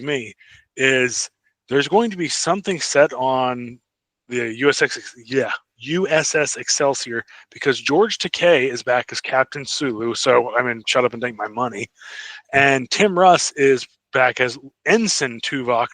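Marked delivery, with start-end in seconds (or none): none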